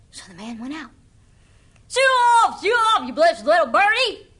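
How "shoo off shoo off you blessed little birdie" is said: high, loud shout at the bird; shooing call. Acted. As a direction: chase the bird off with a big yell. Man shoos bird off roof.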